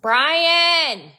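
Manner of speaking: loud high call across the house; loud wake-up call; calling to wake him. Real, not acted. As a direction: shout his name to wake him up.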